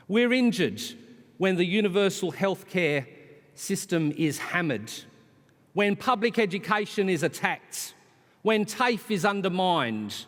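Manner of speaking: high, forceful podium delivery; impassioned political speech. Real, not acted. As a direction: hammer out each grievance to the crowd.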